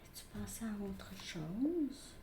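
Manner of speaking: slow whispered question; hushed whisper of the absent lover's question. Retold or imitated. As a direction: breathe the question softly, slowly. Reads card message softly.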